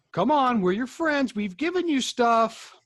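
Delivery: coaxing plea. Acted. Baldo pleads with Muck to calm down, coaxing. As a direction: coax a sulking creature down gently. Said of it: "try to persuade him to calm down".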